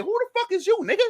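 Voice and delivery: very high, incredulous confrontation; retold defiant challenge. Says challenge again to the man.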